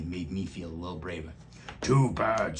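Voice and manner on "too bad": dismissive, louder bug voice; the stink bug waving off the bunnies' love. A narrator's reading. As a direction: brush them off, smug. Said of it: "said the stink bug".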